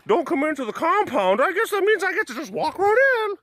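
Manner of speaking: high, cocky mock voice of a careless visitor. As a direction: sneer it out as a rule-breaking visitor. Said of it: "People just still say".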